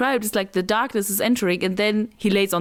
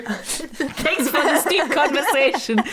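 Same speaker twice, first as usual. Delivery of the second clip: higher, laughter running through it; laughing mock gratitude; 4 semitones higher than usual. Real, not acted. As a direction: joke through real laughter. Mock-grand thanks amid shared laughter.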